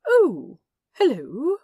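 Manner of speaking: high, loud, bright oh hello; delighted greeting. A narrator's reading. Angel greets the demon, high and loud with delight.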